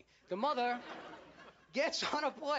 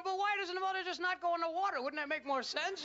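higher, protesting question; mock bafflement; 4 semitones higher than usual. Viewer questions the movie's logic, mock bafflement. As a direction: play the puzzled viewer objecting.

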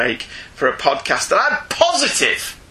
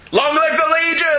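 rallying cry; 11 semitones higher than usual. Retold or imitated. Host reading a Legion fan's email in a mock rallying cry. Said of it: "put that in really big letters".

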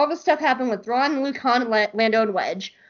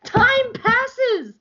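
incredulous rant; 8 semitones higher, slower than usual. Real, not acted. Ranting about the comic.

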